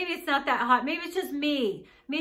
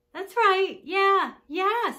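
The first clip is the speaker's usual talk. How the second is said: loud, higher-pitched reassurance; loud confirming; 6 semitones higher, 6 dB louder, much slower than usual. Acted. Visitor confirming to Gran who she is, loud and high. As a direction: confirm it brightly and loudly for Gran.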